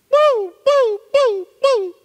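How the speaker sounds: very high, loud mock police siren wail; high mock siren boo boo; siren imitation. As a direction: wail it like a cop siren behind you.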